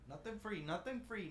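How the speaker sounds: hammy fake Japanese accent, light; mock Japanese accent. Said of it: "the worst Japanese accents I've ever heard".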